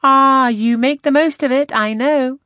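put-on high, loud young lady's exclamation; crying out eagerly. A narrator's reading. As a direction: cry out in animated protest. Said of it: "cried Louisa".

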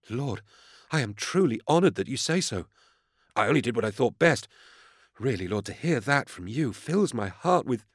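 high, reverent admirer's voice; fawning junior inquisitor. A narrator's reading. Vervoek thanking his lord for praise, fawning gratitude. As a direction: gush with gratitude to your idol.

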